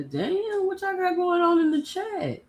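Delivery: drawn-up high pitch of disbelief; taken aback. Real, not acted. Shocked by a comment in chat.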